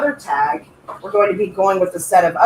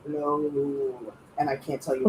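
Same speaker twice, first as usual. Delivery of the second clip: voice dropping low; 6 semitones lower, 11 dB quieter than usual. Real, not acted. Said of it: "my voice is going".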